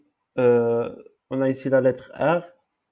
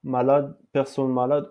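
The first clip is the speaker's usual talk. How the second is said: usual speaking voice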